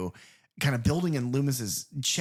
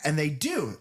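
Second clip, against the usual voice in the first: ordinary delivery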